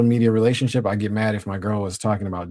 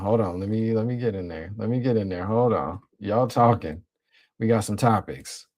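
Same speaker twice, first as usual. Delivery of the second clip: usual speaking voice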